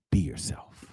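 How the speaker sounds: put-on whispered, low, as the old mentor; whispered advice. Elderly mentor giving his final advice, in a low whisper. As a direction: breathe it out like a wise secret. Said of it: "He said".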